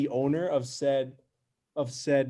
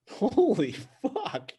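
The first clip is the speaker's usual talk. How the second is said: high, slow, laughing holy fuck; laughing disbelief; 10 semitones higher, much slower than usual. Real, not acted. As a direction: burst out in shocked laughter. Laughing shock at odd story.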